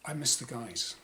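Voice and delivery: usual speaking voice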